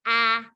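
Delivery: "ah" with mouth wide and tongue flat; a lone "ah", the vowel in cat; short A sound. Shown as a demonstration. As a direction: open up on "ah" as in cat. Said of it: "my mouth is nice and wide".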